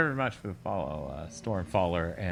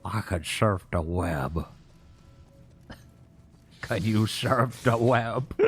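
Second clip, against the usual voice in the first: slow, drawn-out mock boast; lightly hammed-up, slow tech bragging; mock computer whiz; slower than usual. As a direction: stretch the words out in jokey imitation. Mocking game's computer-skills dialogue.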